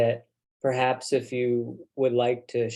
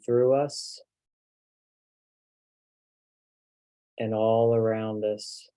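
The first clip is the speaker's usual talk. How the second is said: spoken prayer. Real, not acted. Minister prays for the group to be centered and grounded, hushed prayer. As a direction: pray calmly, letting each phrase rest before the next.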